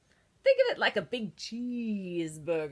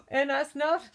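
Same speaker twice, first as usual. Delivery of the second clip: sneeze building; 7 semitones higher, 6 dB louder than usual. Acted.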